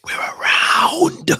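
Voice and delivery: long, forceful whispered yell; whispered shout. Real, not acted. Streamer celebrating while the kids sleep, whisper-shouting. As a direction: scream it in a whisper, drawn out. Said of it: "He's doing the loud silence".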